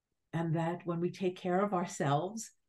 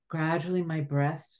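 normal talking voice

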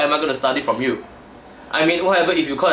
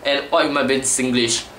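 ordinary talk, nothing marked

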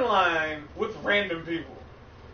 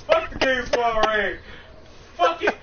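louder, bitter repeated cursing; salty rant; 4 semitones higher, 6 dB louder than usual. Real, not acted. Gamer venting salt on stream to friends, angry. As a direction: vent the salt, loud. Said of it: "You're salty right now".

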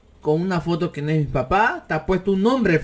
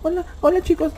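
high mock hello; a hint of a prissy greeting; 11 semitones higher than usual. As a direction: squeak out a mocking hello. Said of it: "No tienes que estar".

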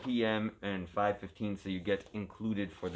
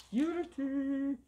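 high drawn-out; much slower than usual. Pretend.

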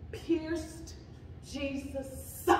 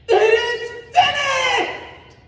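very high, drawn-out shout; triumphant loud cry; 12 semitones higher, 12 dB louder, slower than usual. A narrator's reading. Jesus on the cross after receiving the drink, loud cry. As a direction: cry it out slowly with full force.

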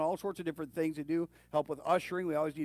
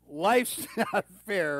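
loud, slow mock-parent comeback, higher pitched; parent's retort; 4 semitones higher, 7 dB louder, much slower than usual. Parent answering a kid's complaint, loud drawn-out retort. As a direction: hammer it out like a fed-up parent. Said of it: "What do you tell your kids".

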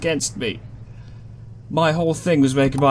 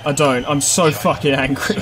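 ordinary talk

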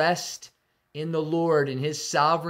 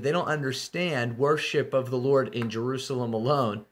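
usual speaking voice

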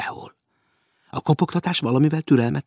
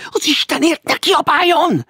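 high, loud frantic protest; alarmed plea; 6 dB louder than usual. A narrator's reading. Maud grabs his arm, pleading in alarm. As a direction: plead desperately to stop the noise. Woman begs shouting man to stop.

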